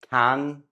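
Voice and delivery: Can with short open A. Shown as a demonstration. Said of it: "you say them differently".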